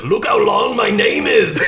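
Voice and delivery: excited Schwarzenegger. Retold or imitated. Schwarzenegger bragging to DeVito about his name, high excited shout. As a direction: bellow it like a thrilled giant child. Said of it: "He's like".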